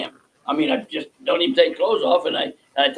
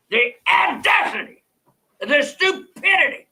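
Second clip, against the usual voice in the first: higher, slow, scornful outrage; outraged disbelief; 12 semitones higher than usual. Real, not acted. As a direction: savor each insult slowly. Host's outrage at officials.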